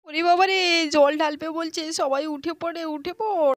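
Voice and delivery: put-on high, loud, hurried child voices; alarmed children. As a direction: scramble everyone awake in mock panic. Voices children alarmed by water threat. (ভয় পেয়ে বাচ্চাদের হুড়োহুড়ি)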